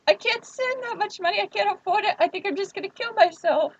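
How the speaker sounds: high, overacted despair; mock distraught. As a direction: fake a breakdown to stall the scammer. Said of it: "all the emotional trauma in my voice".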